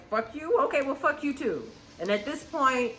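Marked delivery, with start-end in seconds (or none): 0.1-1.6 indignant comeback. Retold or imitated. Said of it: "it's like".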